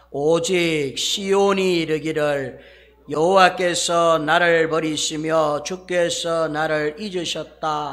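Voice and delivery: slow, loud unison scripture reading; congregation reading. Shown as a demonstration. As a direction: read it out together, slowly. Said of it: "다시 한번 읽어보세요". (천천히 함께 봉독하듯)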